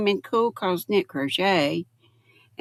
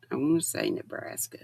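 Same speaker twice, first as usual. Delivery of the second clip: quick whisper to herself; fast soft whisper; whispered aside; 6 semitones lower, 8 dB quieter, faster than usual. Real, not acted. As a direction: hush it as a private correction. Whispered self-correction aside.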